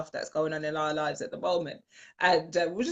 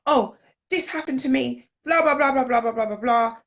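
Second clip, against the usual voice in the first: higher, louder hammed-up moaning; mock self-pitying rant; 6 semitones higher, 5 dB louder than usual. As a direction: parody your own victim mode. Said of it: "was like".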